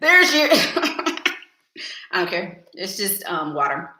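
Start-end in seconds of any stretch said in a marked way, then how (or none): 0.0-1.3 laughing. Real, not acted. Laughing about her cup.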